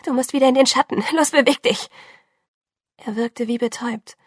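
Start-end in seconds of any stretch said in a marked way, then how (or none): 0.0-1.9 fast, higher, rushed urging; a frightened push to get him into the shadow; urgent pleading. Acted. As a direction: hurry him out of the sun in alarm. Urging him back into shade.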